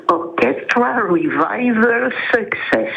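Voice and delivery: band name said slowly in an English accent. Shown as a demonstration. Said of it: "mon accent anglais". (nom du groupe dit avec l'accent anglais)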